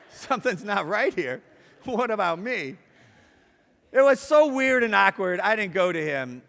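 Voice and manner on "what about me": ordinary talk, nothing marked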